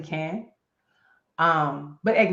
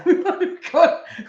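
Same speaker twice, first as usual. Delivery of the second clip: loud laugh at her own joke; high burst of laughter; 9 semitones higher, 5 dB louder than usual. Real, not acted. To the audience after her own joke, a loud high laugh. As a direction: crack up.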